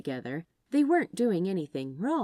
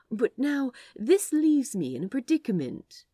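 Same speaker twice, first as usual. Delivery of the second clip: higher, louder, hammed-up musing; theatrical musing; 8 semitones higher, 5 dB louder than usual. A narrator's reading. Aziraphale teasing Crowley, theatrical musing. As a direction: overplay the dilemma for effect. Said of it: "mused out loud ... more theatrically".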